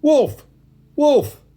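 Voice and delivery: high, loud cry of alarm; shout. A narrator's reading. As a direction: shout the alarm across a field. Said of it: "he shouted".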